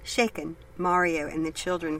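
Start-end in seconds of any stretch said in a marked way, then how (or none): none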